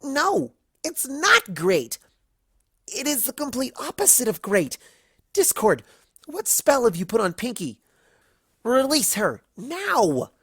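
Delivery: voiced Twilight demanding a release. A narrator's reading. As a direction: order it firmly. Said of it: "Twilight demanded".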